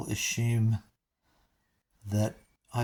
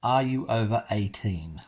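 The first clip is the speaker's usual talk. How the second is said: deliberate, evenly spaced repeat; measured, spelled-out question; nice and slowly. Shown as a demonstration. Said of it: "I'll repeat that nice and slowly".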